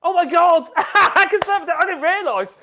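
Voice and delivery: loud, high-pitched burst of laughter and surprise; laughing exclamation. Real, not acted. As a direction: exclaim it loudly through real laughter. Startled laugh at forgotten viewer.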